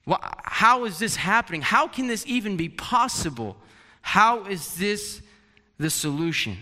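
bewildered. Retold or imitated. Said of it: "even more questions now".

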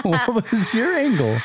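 asked high through laughter; laughing question. Real, not acted. Laughing while asking.

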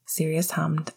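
ordinary delivery

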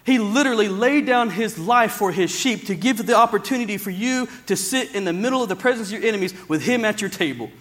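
ordinary talk

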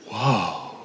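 ordinary talk

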